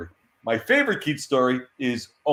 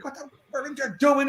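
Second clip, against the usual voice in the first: high, strained outrage without real volume; mock yelling; 14 semitones higher than usual. Editor losing it at the artist, high-pitched mock yell. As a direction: imitate yourself losing it, pitched way up. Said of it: "And I'm yelling, I'm yelling".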